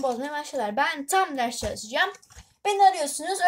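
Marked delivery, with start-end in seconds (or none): 2.6-3.4 high, loud fed-up complaint; exasperated complaint. Real, not acted. Girl annoyed at family interrupting her study, high loud complaint.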